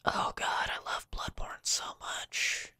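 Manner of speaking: soft, slow, reverent whisper; hushed adoring whisper about a beloved game. Real, not acted. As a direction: whisper it like a confession of love. Gushing about a favourite game.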